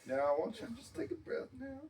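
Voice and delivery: soft, calming operator voice; soothing dispatcher. Pretend. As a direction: talk him down gently. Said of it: "this is Celeste".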